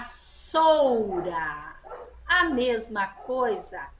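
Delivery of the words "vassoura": "Vassoura" drawn out slowly with a strong hissed S; strong S sound. Shown as a demonstration. Teacher showing double S to pupils, strong S in "Vassoura". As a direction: stretch "Vassoura", holding a crisp S between the vowels. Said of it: "Som forte do S".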